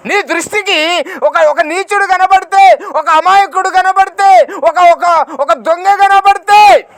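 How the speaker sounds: loud, high, ringing proclamation of scripture; preaching at full force. Acted. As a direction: cry the verse out to the congregation. Preacher builds to a cry. (గట్టిగా ప్రసంగ స్వరం)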